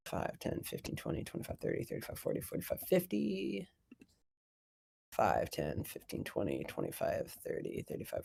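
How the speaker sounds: very soft, low mutter to self; counting under breath. Real, not acted. Game master counting out movement, muttered under breath. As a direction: count off squares barely audibly.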